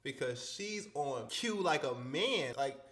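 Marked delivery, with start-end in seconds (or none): none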